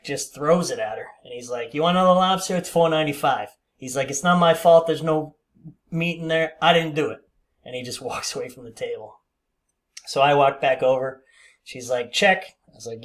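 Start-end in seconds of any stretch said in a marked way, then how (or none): 1.7-3.5 loud, higher mock-irate boss; retold confrontation. Boss confronting a customer over her lobster, retold confrontational outburst. 4.1-7.2 imitating a boss loudly shirking blame; raised voice, indignantly deny any fault; retold defensive outburst. Boss denying blame to a diner, retold defensive outburst. 12.1-12.6 louder, higher mock curt customer; retold curt demand. Snubbed diner asking waiter for the bill, retold curt demand.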